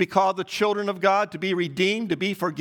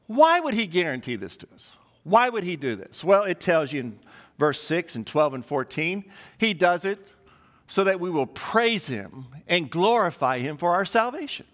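usual speaking voice

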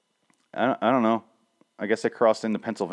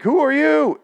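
sharp call; 6 dB louder, slower than usual. Retold or imitated. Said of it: "and then like".

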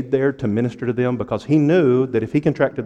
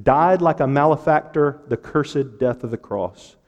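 raised, driving sermon cadence; higher-pitched pulpit proclamation; impassioned preaching; 4 semitones higher, 4 dB louder than usual. Real, not acted. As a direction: preach it in a rolling list of clauses. Preacher recites gospel at full force.

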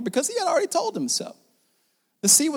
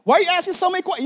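defensive; 9 semitones higher, much faster than usual. Acted.

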